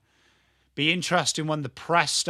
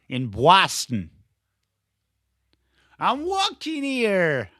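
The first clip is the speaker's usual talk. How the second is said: hammed-up, drawn-out Boston accent; bad Boston accent; 3 semitones higher, 3 dB louder, slower than usual. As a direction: do a terrible, exaggerated Boston accent. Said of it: "worst Boston accent I've ever done".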